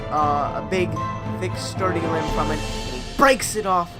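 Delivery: ordinary delivery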